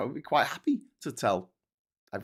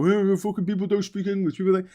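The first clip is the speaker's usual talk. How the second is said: high, louder mocking rant; 9 semitones higher, 5 dB louder than usual. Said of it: "when you get racists who go".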